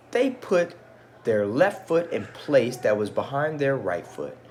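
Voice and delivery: put-on storytelling voice for children; gentle read-aloud storyteller; story narration. A narrator's reading. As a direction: read it like a picture book aloud.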